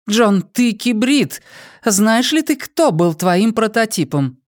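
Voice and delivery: high, cool woman's voice; Dayana's voice. Acted. Dayana questioning the drugged prisoner, high and cold. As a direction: play the cold interrogator lady.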